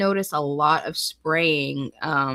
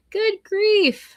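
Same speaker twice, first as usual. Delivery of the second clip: Good grief, much higher and a bit louder, falling hard; exaggerated exasperation; 12 semitones higher, 3 dB louder than usual. Real, not acted. Streamer repeating her reaction to the heat, hammed-up dismay. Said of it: "repeated bigger reaction".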